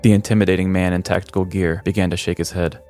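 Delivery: ordinary talk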